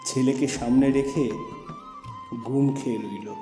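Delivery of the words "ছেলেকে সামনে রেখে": plain reading voice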